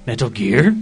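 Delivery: lightly gruff Snake voice, questioning; a hint of Solid Snake; Solid Snake impression. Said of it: "my Snake impression".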